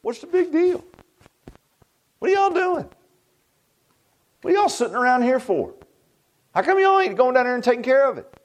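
David at camp, scoffing at the soldiers, high and eager; young David. Retold or imitated. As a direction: toss it off like a fearless farm boy. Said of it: "and he says".

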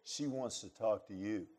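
soft, low imitation of mouthing; quietly imitated silent message; mouthed message. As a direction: pass it on discreetly, hushed and low. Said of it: "and she mouths".

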